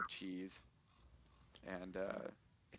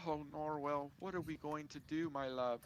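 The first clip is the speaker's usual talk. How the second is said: higher voice of a fretting wife, a touch of fear; the wife turns to her husband, anxious and pleading; higher, mildly frightened plea to her love; worried wife; 7 semitones higher than usual. Acted. Wife clings to husband fearfully.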